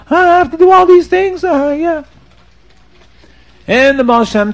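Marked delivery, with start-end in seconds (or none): 0.1-2.0 high, loud hammed-up wail; crying like a baby. Sinner confesses to the Baal Shem Tov, mock bawling. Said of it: "crying and screaming in the shul like a baby".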